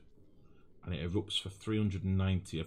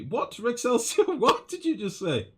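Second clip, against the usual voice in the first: baffled; 7 dB louder than usual. Real, not acted. Baffled by chat's spaghetti word.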